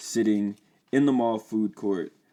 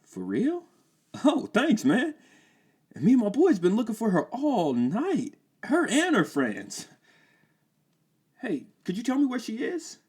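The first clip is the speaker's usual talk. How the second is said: high, bright-pitched eager asking; eager teen voice; 4 dB louder than usual. Acted. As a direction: sound keen and hopeful.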